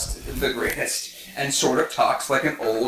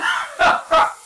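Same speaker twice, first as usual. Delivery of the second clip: loud laugh; 4 dB louder than usual. Real, not acted. Punchline laugh.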